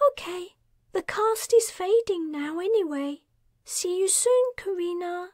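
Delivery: put-on young man Darius, cheerful. A narrator's reading. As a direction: greet her brightly.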